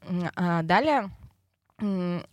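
ordinary delivery

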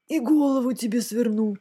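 understated monster grumble; grumbling dragon head. A narrator's reading. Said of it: "проворчала шестая".

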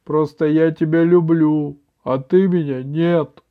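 through tears. A narrator's reading. Grandmother telling grandson he doesn't love her, through tears. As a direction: reproach him as if crying. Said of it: "сквозь слёзы ответила бабушка".

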